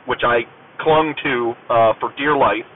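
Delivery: ordinary delivery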